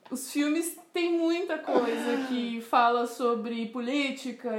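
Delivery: usual speaking voice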